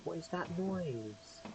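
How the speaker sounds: slow, drawn-out creepy question; slow spooked repeat. Acted. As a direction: stretch it out like something's listening.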